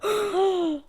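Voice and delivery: loud, high dramatic gasp; mock shocked gasp. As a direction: gasp theatrically at the plot twist. Mock suspense at plot reveal.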